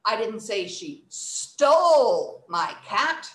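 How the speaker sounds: STOLE loud and very long; slow line, "stole" stretched out loudly; stress on STOLE. Shown as a demonstration. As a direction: hit "stole" hard and hold it. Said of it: "let's try another one".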